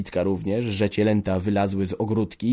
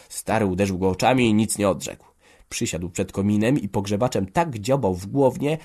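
usual speaking voice

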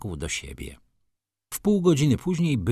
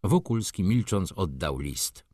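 ordinary delivery

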